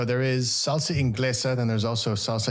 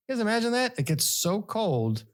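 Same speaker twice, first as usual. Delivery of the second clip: mock amazement; 12 semitones higher than usual.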